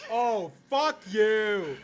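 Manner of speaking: loud, high, drawn-out shout; real angry yell. Real, not acted. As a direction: yell each word long and loud at the game. Startled by the game, yells back.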